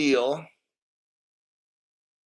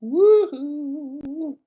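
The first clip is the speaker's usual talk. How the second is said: high falsetto whoop; a cheerful high cheer; excited whoop; 12 semitones higher than usual. Real, not acted. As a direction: let out a whoop of delight. Celebrating the gold coin.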